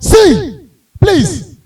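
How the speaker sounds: loud exasperated yelling, repeated; pissed-off shout. Retold or imitated. Comedian snapping at the caller on the phone, loud and angry. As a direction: bark it down the phone, fed up. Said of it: "I was pissed".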